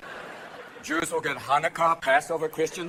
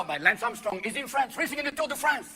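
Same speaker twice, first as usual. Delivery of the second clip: usual speaking voice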